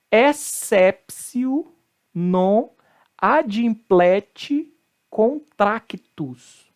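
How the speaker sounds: very slow word-by-word model, exceptio as "essépsio"; correct Latin pronunciation. Shown as a demonstration. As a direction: spell out exceptio slowly with T as S. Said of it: "o T tem som de C".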